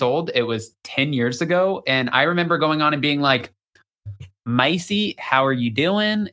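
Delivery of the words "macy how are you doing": mild imitation of a slow Southern drawl; young interviewer greeting in a hint of Southern drawl. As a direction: lightly put on a thick Southern accent, slowed down. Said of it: "such a thick Southern drawl".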